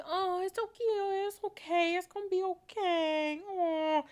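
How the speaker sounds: high, sing-song mock comforting; syrupy pretend consoling in a high voice; mock consoler. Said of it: "I don't like people consoling me".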